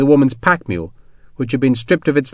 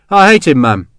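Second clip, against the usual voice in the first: flat hatred; 2 semitones higher, 6 dB louder than usual. Acted. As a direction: spit out his hatred of the major. Sharp declares his hatred of Dodd.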